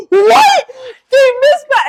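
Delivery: very high, loud imitated outrage; hammed-up incredulous yell; outraged shriek. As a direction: shriek it as a scandalized mother. Acts mom's outraged reaction.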